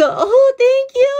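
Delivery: very high, slow, swooning thank you; giddy thanks. Retold or imitated. Retelling her reply to the compliment, high and slow. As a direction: swoon it out, flattered. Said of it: "Sabi ko".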